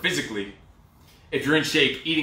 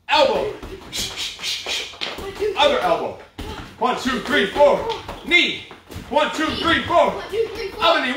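pumped-up coach calling; 7 semitones higher, slower than usual. Acted. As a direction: fire up the class through the last round. Coach calls burnout round.

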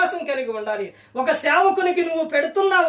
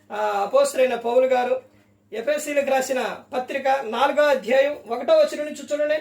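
normal talking voice

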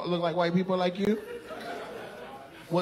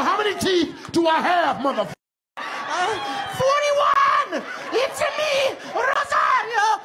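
mock shout; much slower than usual. Comedian yells a wild shouted character bit at crowd.

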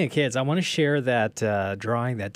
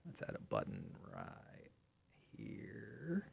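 hushed aside; 18 dB quieter than usual. Real, not acted. Adding a button on screen, quiet whispered aside. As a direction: whisper under the breath.

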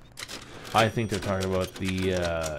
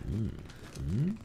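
ordinary talk, nothing marked

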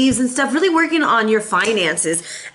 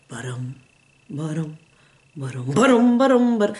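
drumroll; 7 semitones lower than usual. Shown as a demonstration. Reader teasing viewers before the reveal with slow drumbeat. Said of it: "a little slow take".

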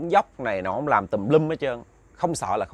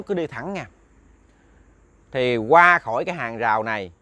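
ordinary delivery, nothing marked

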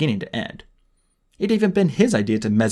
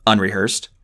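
ordinary delivery

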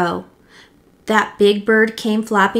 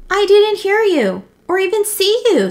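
high-pitched, louder iguana voice; protesting iguana; 11 semitones higher, 5 dB louder than usual. A narrator's reading. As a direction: plead innocence in a high voice. Accused iguana protests in the story.

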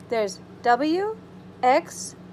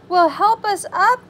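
higher, louder plea; mild cry for help; 6 semitones higher, 5 dB louder than usual. A narrator's reading. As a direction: call out for help a little plaintively. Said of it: "cried A B C".

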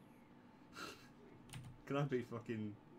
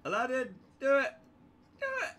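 high loud urging, building; urging excitedly; 9 dB louder, faster than usual. Real, not acted. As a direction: cheer the teammate on.

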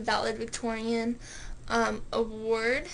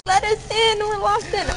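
calling out; 10 semitones higher, 6 dB louder than usual. Real, not acted. Locked in mall, calling out.

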